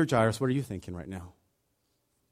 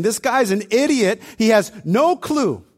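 higher, louder scoffing; mocking; 8 semitones higher, 6 dB louder than usual. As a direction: sneer at him out loud. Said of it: "by their mocking laughter".

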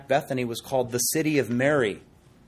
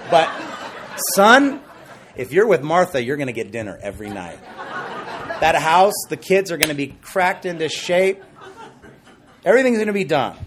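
laughing fatherly pitch; 4 semitones higher, 7 dB louder than usual. Retold or imitated. Father advising son, laughing.

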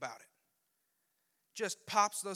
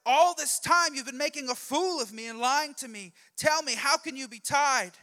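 high, loud accusing Delilah; exasperated outburst; 8 semitones higher, 10 dB louder than usual. Acted. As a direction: berate him and demand an answer. Delilah, fooled twice, confronts Samson.

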